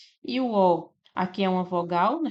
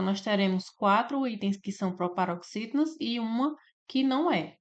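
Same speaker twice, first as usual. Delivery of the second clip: normal talking voice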